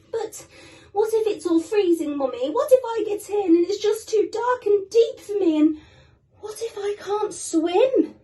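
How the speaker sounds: high, loud, worried little-chick voice; scared little penguin. Acted. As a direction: plead like a frightened small child.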